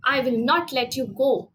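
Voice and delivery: ordinary delivery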